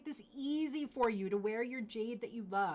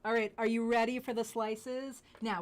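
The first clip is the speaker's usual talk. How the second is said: ordinary delivery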